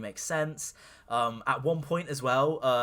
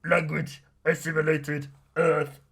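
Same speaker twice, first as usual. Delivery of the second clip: machine voice; 2 semitones higher, 3 dB louder, slower than usual. Retold or imitated. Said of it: "and it goes".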